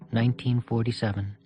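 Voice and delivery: ordinary delivery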